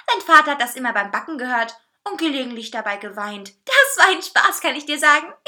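loud, high, cocky cake voice; condescending amusement, ending in a chuckle; smug laughing. A narrator's reading. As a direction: brag with amused superiority. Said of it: "lachte er überheblich".

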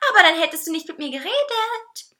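high, loud cake whine; whiny, high-pitched complaint; whining. A narrator's reading. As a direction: whine like a pestering child. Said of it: "quengelte der Kuchen".

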